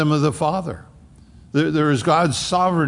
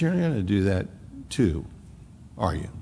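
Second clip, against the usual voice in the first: softer, lower, pressing the word not; soft searching question; 6 semitones lower, 6 dB quieter than usual. Acted. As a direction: ask it gently but pointedly, voice dropping. Jesus voiced asking the twelve.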